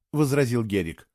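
ordinary delivery, nothing marked

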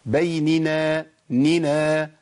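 بيننا chanted slowly, نِ and نا each distinct; each letter given its due. Shown as a demonstration. Teacher modelling correct recitation, slow and measured nūns. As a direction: stretch بيننا out, separating the two ن. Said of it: "ادي كل حرف حقه".

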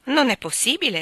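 mother exclaims in disbelief at the news; exclaiming. A narrator's reading. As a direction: burst out in disbelief. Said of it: "esclamò mia madre".

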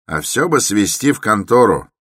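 high, loud suggestion; insistent townsman. A narrator's reading. Townsman still wants the stranger taken in, loud and insistent. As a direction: keep pressing the point loudly. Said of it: "прибавил мещанин".

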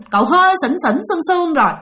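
ordinary delivery

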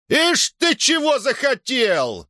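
high, drawn-out scornful yell; jeering shout. A narrator's reading. Rich man in the crowd heckles the speaker, shouting. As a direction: yell it slowly, pitched high. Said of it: "крикнули из толпы богачей".

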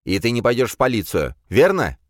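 pressing. Acted. Paul presses her.

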